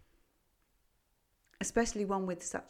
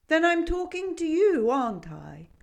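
loud, high, mildly cross goat; 9 semitones higher, 11 dB louder than usual. A narrator's reading. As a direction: bristle at the obvious. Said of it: "The goat was a little cross at this".

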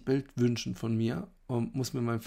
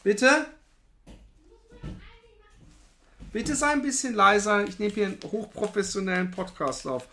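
loud, pleading, half joking; exasperated loud plea; 9 semitones higher, 5 dB louder than usual. Real, not acted. Asking partner to be quieter.

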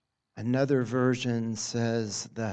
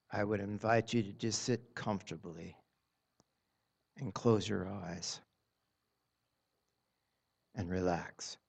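soft spa whisper; 3 semitones lower, 4 dB quieter than usual. Acted. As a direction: ease them gently into stillness. Leads a guided meditation.

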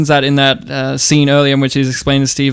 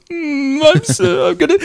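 mock sorrowful; 12 semitones higher than usual. Imitating a con man playing up his sorrow, mock pitiful. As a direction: put on a pitiful little act. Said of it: "sorrowful look of".

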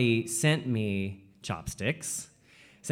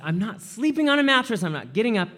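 put-on high, louder childish refusal; stubborn toddler; 10 semitones higher, 4 dB louder, faster than usual. Toddler to parents, a high insistent refusal. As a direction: demand it like a three-year-old. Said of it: "I was just like".

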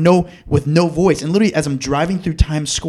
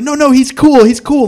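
higher, louder quick call to back off; urgent reassurance; 9 semitones higher, 7 dB louder than usual. Retold or imitated. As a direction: wave security off loudly. Crowd vouching for him to security.

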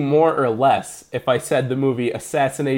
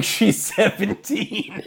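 slow, laughing; 7 semitones higher, 4 dB quieter, much slower than usual. Retold or imitated. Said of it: "I was like".

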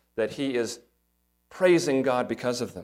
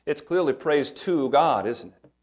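usual speaking voice

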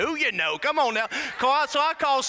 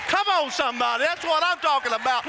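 fired-up preacher rallying the crowd; excited exhortation; faster than usual. Real, not acted. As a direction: urge the congregation on excitedly. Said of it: "I just got excited".

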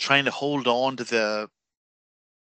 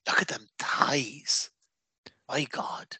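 breathless, leering whisper, slowed down; inner voice whisper; 5 dB quieter, slower than usual. Retold or imitated. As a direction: whisper it like a guilty secret thought. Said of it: "an even bigger part of me was going".

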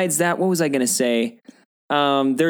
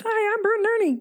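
much higher squeaky puppet greeting; Bert and Ernie voice. Retold or imitated. Recalling Sesame Street, squeaky puppet voice. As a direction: pitch way up like a Muppet. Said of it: "Bert and Ernie were like".

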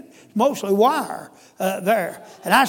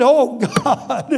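retold cry to God; 2 semitones higher, 6 dB louder than usual. Convicted, cries out to God.

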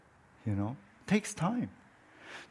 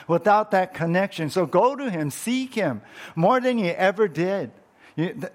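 ordinary talk, nothing marked

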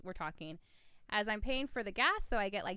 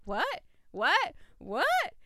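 each "what" climbing higher and louder; escalating disbelief; 7 semitones higher, 5 dB louder, much slower than usual. Retold or imitated. Woman realising she has the wrong man, escalating disbelief. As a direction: repeat it with mounting comic bafflement, ever higher.

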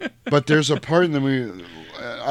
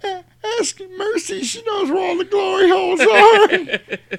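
very high, crying, loud mock wail; hammed-up hysterical cowardly pleading; Luther begging; 5 dB louder than usual. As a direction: sob and squeal the offer. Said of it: "hear Luther saying that".

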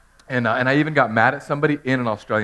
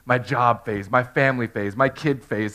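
ordinary talk, nothing marked

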